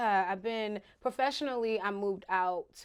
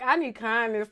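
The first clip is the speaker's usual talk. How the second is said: ordinary talk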